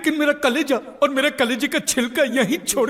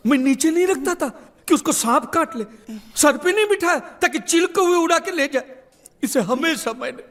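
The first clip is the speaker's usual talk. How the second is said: ordinary delivery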